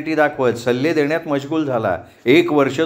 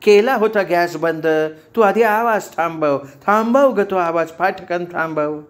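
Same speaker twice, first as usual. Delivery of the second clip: higher, urgent exasperated orders; mock yelling; 3 semitones higher than usual. A narrator's reading. Roommate chasing the other about the alarm, yelling. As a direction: call out urgently with contained volume. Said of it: "मेघना तिच्या मागून ओरडत".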